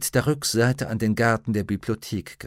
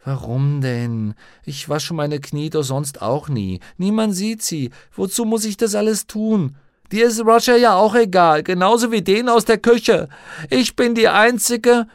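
whining, building to high loud outburst; protesting girl; 10 semitones higher, 6 dB louder than usual. A narrator's reading. Lyra objecting to being washed, defiant protest. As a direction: argue back, climbing into indignant complaint.

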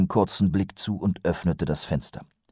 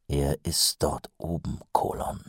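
low, soft grumble; growled low; 3 semitones lower, 8 dB quieter than usual. A narrator's reading. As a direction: mutter it darkly under your breath. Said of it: "brummte Mumm". (leise gebrummt)

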